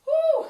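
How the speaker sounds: loud high whoop; cheer. Real, not acted. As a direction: whoop to fire up the class. Cheering the class through a workout.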